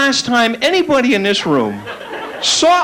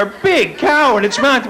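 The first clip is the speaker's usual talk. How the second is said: normal talking voice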